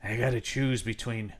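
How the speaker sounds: solemn movie-pastor murmur; praying pastor. Acted. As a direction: pray it quietly with heavy pauses. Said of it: "is praying to God, saying".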